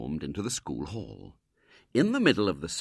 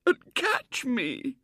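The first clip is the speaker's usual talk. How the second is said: high, slow, tearful wail; bursting into sobs; 5 dB louder, much slower than usual. A narrator's reading. Girl fearing she'll be caught, breaking into sobs. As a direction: let the words dissolve into crying. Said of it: "She burst into sobs again".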